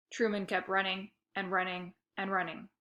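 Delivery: plain reading voice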